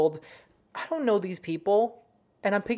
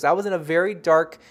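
normal talking voice

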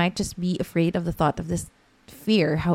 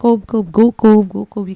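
loud, higher-pitched cheering chant; imitated cheering; 3 semitones higher, 12 dB louder than usual. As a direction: cheer a child on, re-enacted. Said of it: "we just kept cheering for him".

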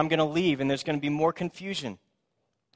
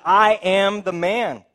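insisting; 5 semitones higher, 9 dB louder, much slower than usual. Acted. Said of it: "he himself insisted".

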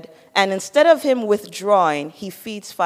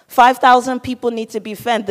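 ordinary talk, nothing marked

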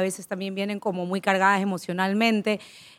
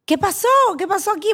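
much higher, startled mock alarm; alarmed aunt; 12 semitones higher, 3 dB louder, faster than usual. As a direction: imitate the aunt bursting in, shocked. La tía entra alarmada. (tía alarmada imitada, mucho más aguda)

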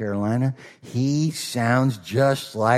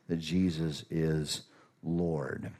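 hushed; 6 semitones lower, 11 dB quieter, slower than usual. Acted. Closing the creed, hushed.

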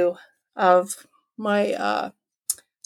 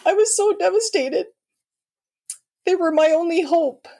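near tears; 9 semitones higher than usual. Real, not acted. Recalling devastating rejection.

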